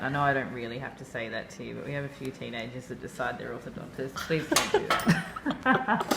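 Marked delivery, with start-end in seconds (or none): 4.1-6.2 laughing. Real, not acted. Pleading with teen patients.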